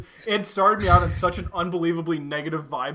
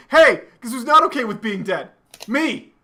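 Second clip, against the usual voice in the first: higher, louder spiteful rant; hammed-up vengeful tirade; mock angry rant; 6 semitones higher, 4 dB louder than usual. Revived girl cursing her allies, mock angry rant. Character berating former allies.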